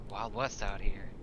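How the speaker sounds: normal talking voice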